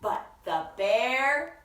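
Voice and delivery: hushed and lilting, with drawn-out words; picture-book story reading. A narrator's reading. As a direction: read to small children, savouring the rhyme. Reads picture book aloud.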